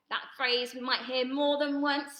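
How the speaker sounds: usual speaking voice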